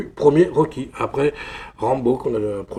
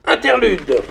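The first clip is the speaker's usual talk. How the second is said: mock announcement; 8 semitones higher, 8 dB louder, slower than usual. Announces a break in video.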